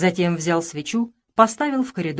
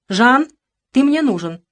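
loud, sharp order; commanding call; 5 semitones higher, 7 dB louder than usual. Acted. Mother seizes her son and orders him. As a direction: grab him with a stern command.

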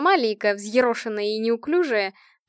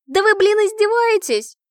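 high, loud exasperated wail; almost shrieking with outrage; near scream; 11 semitones higher, 9 dB louder than usual. A narrator's reading. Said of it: "Почти закричала Лика".

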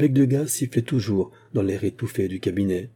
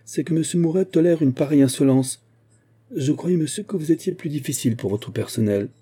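indignant complaint; 6 semitones higher than usual. Acted. Henriette's angry complaint.

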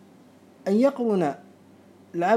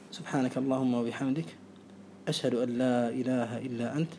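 soft, low murmured supplication; whispered-down final prayer, much softer; quiet closing prayer; 8 semitones lower, 9 dB quieter than usual. Real, not acted. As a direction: close the lesson with a hushed, humble dua. Closing supplication after the lesson.

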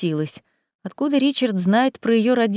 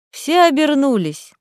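ordinary delivery

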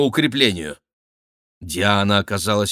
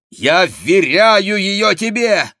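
high, loud, drawn-out plea; urgent shout; 9 semitones higher, 6 dB louder, slower than usual. Acted. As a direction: call it out slowly and high. Продолжает кричать другу. (громкий протяжный крик)

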